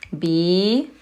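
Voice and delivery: Bir with a long drawn-out i, like beer; long vowel demo. Shown as a demonstration. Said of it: "so it's gonna make the E sound".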